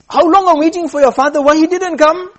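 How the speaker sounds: loud, high-pitched outburst; impatient demand. Acted. King demands why father never came.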